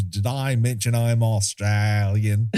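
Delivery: put-on Australian, played up for laughs; mock Australian accent. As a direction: joke in a broad Australian accent. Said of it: "all my accents go Australian".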